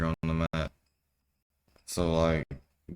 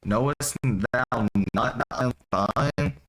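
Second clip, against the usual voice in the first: higher, slow theatrical reading; dramatic emphasis; 5 semitones higher, 3 dB louder than usual. Acted. Young child rebuking his father in scripture, dramatic emphasis. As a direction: draw the words out as a lofty challenge.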